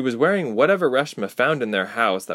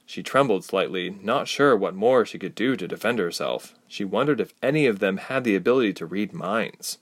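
usual speaking voice

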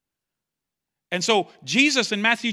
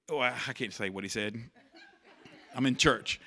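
laughing aside; 9 semitones lower, 9 dB quieter than usual. Real, not acted. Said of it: "laughing aside".

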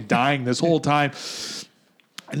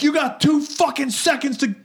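high, raised furious threat; to the kid biting his finger, raised furious threat; 7 semitones higher than usual. Retold or imitated. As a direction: threaten him to let go. Threatening the biter.

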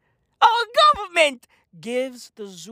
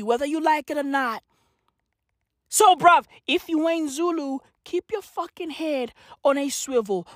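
ordinary talk

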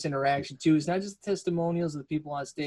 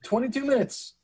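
lightly voiced nervous pitch lady; imitating infomercial lady; 4 semitones higher than usual. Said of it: "a lady who mispronounced ingredients".

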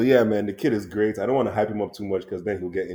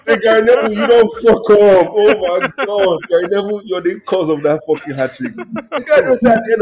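much higher and louder, laughing through it; excited laughing rant; 11 semitones higher, 9 dB louder than usual. Real, not acted. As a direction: rant with delight, half laughing. Laughing rant about Gary Neville.

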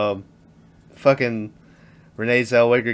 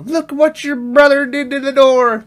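much higher, louder character voice; imitating the original; 12 semitones higher, 5 dB louder than usual. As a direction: ham up the original actor's line. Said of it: "no one's as good as the original".

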